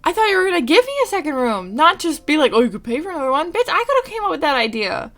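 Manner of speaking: loud, high-pitched indignant tirade; outraged rant. Retold or imitated. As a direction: fire back in loud exasperation. Outraged at paying for second room.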